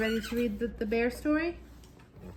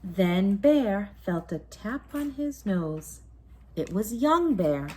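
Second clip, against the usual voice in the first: story narration; 7 dB louder, slower than usual. A narrator's reading. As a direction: tell it like a bedtime story to a child. Bedtime story narration continues.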